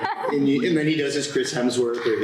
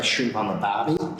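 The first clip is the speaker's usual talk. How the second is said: attempted Australian accent, lower; 4 semitones lower than usual. Shown as a demonstration. Trying out an Australian, attempted Australian accent. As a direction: try a stock Aussie phrase. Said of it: "I can't do an Australian".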